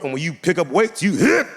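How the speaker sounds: ordinary talk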